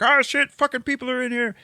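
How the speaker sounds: high-pitched mock alarm; alarmed guard. Junkyard guard spotting intruders, mock alarmed. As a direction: imitate a startled guard crying out.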